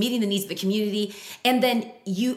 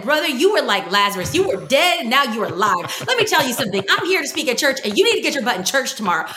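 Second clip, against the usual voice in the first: louder, higher preacher fire; T D Jakes preaching; 3 semitones higher, 5 dB louder than usual. Retold or imitated. Telling the rescued man to get to church, fiery preacher voice. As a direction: preach at him like a revival pulpit. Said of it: "turning into T D Jakes".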